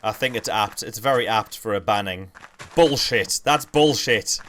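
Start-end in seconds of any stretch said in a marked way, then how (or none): 2.8-4.4 loud, higher catchphrase call; Carl Pilkington. Retold or imitated. Streamer echoing the ban sound effect, loud mimicked outburst. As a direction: call it out loudly like a banning verdict. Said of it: "it's Carl Pilkington bullshit".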